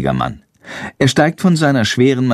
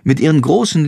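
ordinary delivery, nothing marked

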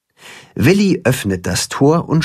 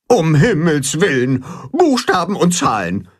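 zeternd; 5 semitones higher than usual. A narrator's reading. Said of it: "zetert Grimm".

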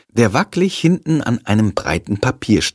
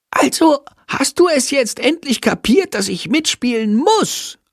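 higher, insistent bat voice, mock whisper; energetic whisper. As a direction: bat nagging at night. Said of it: "Flüstert Sándor energisch".

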